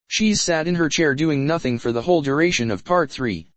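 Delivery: normal talking voice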